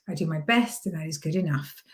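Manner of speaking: "good enough" falling at the end; downward inflection. Shown as a demonstration. Coach showing self-belief, "enough" with falling tone. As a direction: state it surely, dropping on "enough". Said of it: "with a downward inflection".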